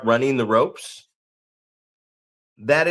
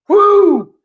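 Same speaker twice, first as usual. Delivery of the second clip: high, loud showboating "woo"; mock an overexcited hop into the ring; energetic whoop; 9 dB louder than usual. Mocking wrestlers hopping into the ring, high energetic whoop. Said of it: "like, all energetic and".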